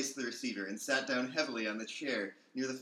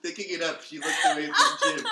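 laughing through words; 12 dB louder than usual. Real, not acted. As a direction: try to read while helpless with laughter. Can't stop laughing mid-reading.

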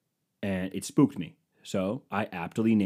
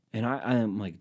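normal talking voice

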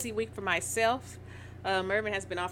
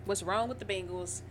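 normal talking voice

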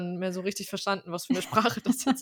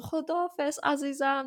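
Chodafez sung out much higher, overjoyed; delighted goodbye; 7 semitones higher than usual. Retold or imitated. Shop woman delighted a customer spoke Persian, high joyful goodbye. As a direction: beam it back like a thrilled shopkeeper. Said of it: "so eine Reaktion".

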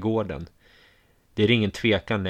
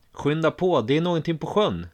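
raised, urgent call, higher; shouting; 6 semitones higher than usual. A narrator's reading. Narrator calling everyone out to the lake, shouting. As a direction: shout the alarm to the hall. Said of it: "skriker jag".

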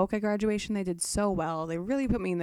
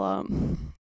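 breathy hum right on the mic; too close to mic, breathing in; 11 semitones lower than usual. Real, not acted. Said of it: "Stop breathing in the mic".

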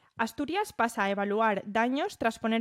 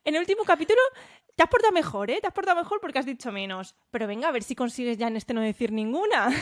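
scolding mother voice; 4 semitones higher, 3 dB louder, faster than usual. Retold or imitated. Mother's voice continues in story.

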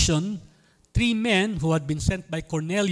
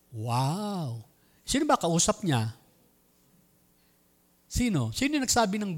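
usual speaking voice

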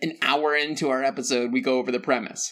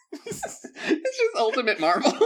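high, laughing through the words; 10 semitones higher than usual. Real, not acted. Host joking about the edgy reboot, laughing. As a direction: crack up while saying it.

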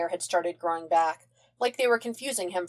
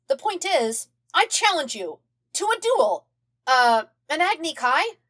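put-on high, eager kid voice; bright, excited young airbender; young boy voice; 8 semitones higher, 3 dB louder than usual. A narrator's reading. Aang voiced by narrator.

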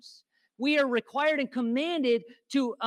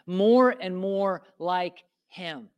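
slow emphasis; 3 semitones lower, 6 dB louder, much slower than usual. Real, not acted. Repeating key phrase slowly, emphatic.

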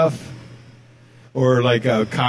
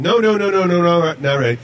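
hurried repeated no's, brushing off the bartender; flustered customer refusing; 6 semitones higher than usual. Retold or imitated. Bar customer waving off the bartender, in a flustered mock refusal.

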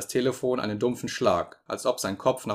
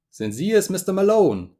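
higher, loud anxious call; calling down the phone; 8 semitones higher, 6 dB louder than usual. A narrator's reading. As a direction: call urgently down a bad line. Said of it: "rief seine wohlbekannte Stimme".

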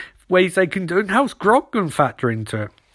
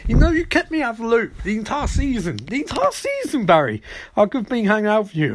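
high-pitched, hammed-up complaint; mock indignant; 7 semitones higher than usual. Iris tells Barry he shut her out, mock indignation. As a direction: play an aggrieved Iris scolding, voice pitched well up.

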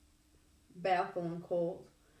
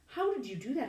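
high, loud amazed question; Percy asks Annabeth, amazed; 6 semitones higher, 5 dB louder than usual. A narrator's reading. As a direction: marvel at what she did. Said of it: "I asked, amazed".

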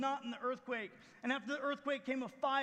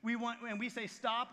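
ordinary delivery

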